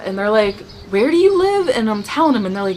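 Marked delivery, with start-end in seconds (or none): 0.9-1.7 much higher, louder startled asking; alarmed question. Retold or imitated. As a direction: coworkers suddenly alarmed, pitch jumping. Coworkers alarmed by her story.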